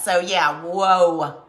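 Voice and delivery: usual speaking voice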